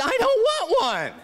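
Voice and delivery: very high, drawn-out comic wail; hammed-up high-pitched protest, slow; horrified refusal. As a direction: squeal it in mock distress.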